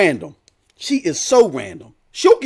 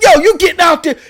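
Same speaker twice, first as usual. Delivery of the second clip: high, loud wife impression; raised-voice retold command; imitated insistent order; 8 semitones higher, 5 dB louder than usual. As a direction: act out a wife laying down the law.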